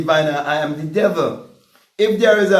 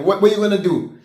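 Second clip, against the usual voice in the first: normal talking voice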